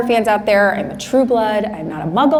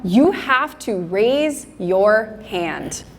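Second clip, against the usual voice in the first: ordinary delivery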